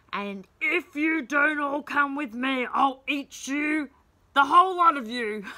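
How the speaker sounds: loud, menacing pirate boom. Acted. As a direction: boom it out like a hungry giant pirate. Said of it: "another voice boomed out".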